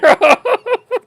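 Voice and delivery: a sudden high-pitched burst of laughter. Acted. Monarch laughing off a long poem, high laugh. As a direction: break into a shrill laugh.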